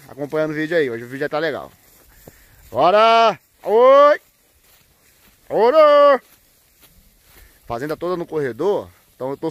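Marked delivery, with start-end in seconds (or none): 2.7-6.2 high, loud herding yells; loud calls to push the herd along; cattle calls. Real, not acted. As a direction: holler to drive the cows on. Herding cows into the corral.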